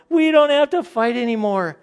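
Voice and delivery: high, loud mock-ecstatic cry; over-the-top celebrating couple; overjoyed shout. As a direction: burst out in hammed-up relief.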